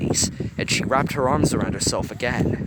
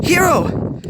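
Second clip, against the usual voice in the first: much higher, loud alarmed call; loud, far higher worried cry; 10 dB louder than usual. A narrator's reading. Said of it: "her tone worried".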